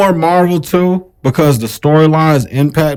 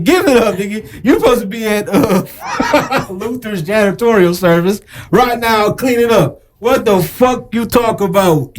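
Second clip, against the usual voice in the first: mock scolding; 6 semitones higher than usual. Acted scolding of older catchphrase users.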